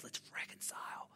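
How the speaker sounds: breathy hushed whisper. Real, not acted. Pastor whispering a gentle call to the congregation. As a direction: drop to a whisper to invite them.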